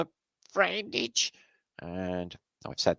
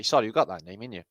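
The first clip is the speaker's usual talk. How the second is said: ordinary talk, nothing marked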